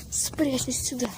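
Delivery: unvoiced whisper while hiding. Real, not acted. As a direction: breathe it out unheard. Hiding from the monster.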